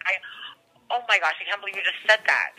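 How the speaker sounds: ordinary talk, nothing marked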